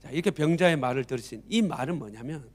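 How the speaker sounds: normal talking voice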